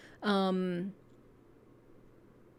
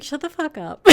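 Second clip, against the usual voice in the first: laughing mock brush-off; 5 semitones higher than usual. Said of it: "she'd be like".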